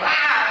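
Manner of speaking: short loud mock roar; imitating a growl. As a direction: growl it out like a wolf. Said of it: "he stands there and he's like".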